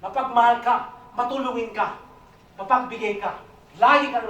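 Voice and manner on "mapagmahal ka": ordinary talk, nothing marked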